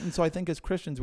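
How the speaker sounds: usual speaking voice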